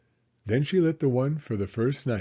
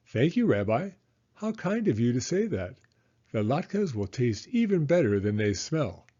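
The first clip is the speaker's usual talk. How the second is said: Baba Brena; 3 semitones higher than usual. Acted. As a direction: play the kindly old hostess, pitched up.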